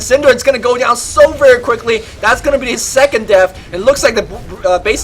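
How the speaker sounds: loud, high, rapid fight call; live play-by-play. Acted. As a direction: call the teamfight at full hype. Live team fight commentary.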